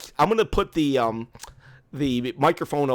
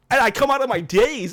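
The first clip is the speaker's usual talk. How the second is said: ordinary talk, nothing marked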